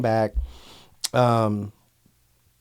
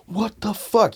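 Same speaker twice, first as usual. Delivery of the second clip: retold angry outburst; 8 semitones higher, slower than usual. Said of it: "a fucking angry-ass call from Wood".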